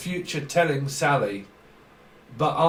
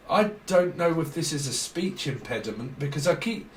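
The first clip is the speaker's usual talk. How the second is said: ordinary delivery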